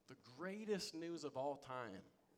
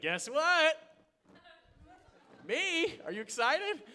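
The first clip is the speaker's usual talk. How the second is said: much higher, louder, bursting with excitement; excited news; 13 semitones higher, 11 dB louder, slower than usual. Retold or imitated. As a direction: blurt out the big news, thrilled. Excited news to her parents.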